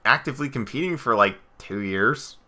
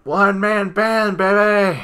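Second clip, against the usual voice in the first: much higher, slow catchphrase call; high drawn-out catchphrase; catchphrase shout; 8 semitones higher, slower than usual. Retold or imitated. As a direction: belt it like a ring entrance. Heath Slater catchphrase.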